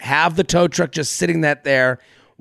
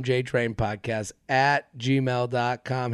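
slow, low and soft; slow repeat; 8 semitones lower, 10 dB quieter, slower than usual. Real, not acted. Host giving listeners the podcast email address, slowly and softly. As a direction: spell it out unhurriedly, dropping the volume.

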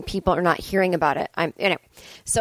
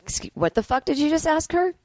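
higher, confrontational handler snapping at a rude asker; mock tough handler; 8 semitones higher than usual. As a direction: act like a protective handler. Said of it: "And they could just be like".

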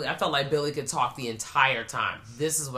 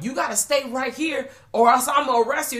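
mock cop; 10 semitones higher, 7 dB louder than usual. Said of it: "[name]'s like".

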